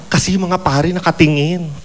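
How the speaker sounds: usual speaking voice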